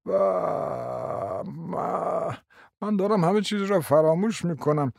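a hint of a doddering prince; old prince. A narrator's reading. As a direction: play a rambling elderly prince. Narrator voices old prince.

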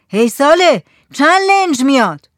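loud, high shouted question; calling out. A narrator's reading. Headman asking the lookout how many launches, calling out. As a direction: hail someone far off.

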